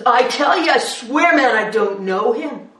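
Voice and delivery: loud desperate oath; swearing denial. Acted. Peter to the accuser, loud desperate swearing. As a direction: swear it with everything.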